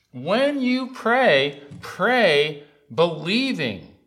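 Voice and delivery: slow, loud preaching with high peaks; forceful, drawn-out pulpit charge; emphatic exhortation. Real, not acted. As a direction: drive each word home to the congregation. Preacher drives the exhortation home.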